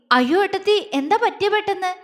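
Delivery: high-pitched, worried exclamation; tense alarm. A narrator's reading. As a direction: react with sudden worry, voice jumping up. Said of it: "അപ്പുവിനും ടെൻഷനായി". (പരിഭ്രമത്തോടെ)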